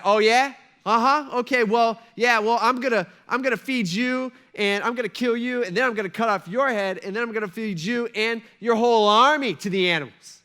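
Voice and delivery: a hammed-up schoolyard boast; mocking one-upper. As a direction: puff up and one-up him, half joking. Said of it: "like, a one-upper?".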